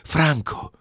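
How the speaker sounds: a high calling cry in the silence. A narrator's reading. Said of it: "gridò ancora la voce nel silenzio".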